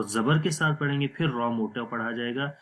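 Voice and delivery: ordinary delivery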